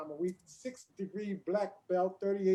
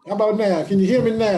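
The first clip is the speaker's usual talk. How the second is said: mic turned up; 3 semitones higher, 17 dB louder than usual. Real, not acted. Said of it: "Much better, much better".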